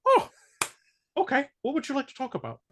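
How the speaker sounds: a hint of an old father caught off guard; mock dad. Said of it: "And he's like".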